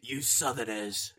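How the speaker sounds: playing along in a northern accent; slow, hammed-up northern jibe; mock northern accent. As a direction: put on a grumbling northern English accent. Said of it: "Apparently, I've gone northern".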